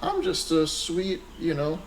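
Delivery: put-on high, slow, soft sweet-girl act; sweet innocent girl voice. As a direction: play the harmless sweet phone girl. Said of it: "humble-sounding girls".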